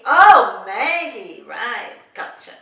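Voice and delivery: loud, drawn-out American "Oh, Maggie"; hammed-up American penny-drop, swooping and slow; mock American realization. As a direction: imitate an American finally getting it. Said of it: "try and say it American style".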